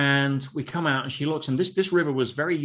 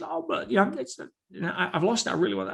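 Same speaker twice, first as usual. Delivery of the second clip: raised voice, lightly playing a distressed fiancée; mock upset fiancée; 6 semitones higher than usual. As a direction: whine it a little as the upset fiancée. Fiancée voiced in retold story.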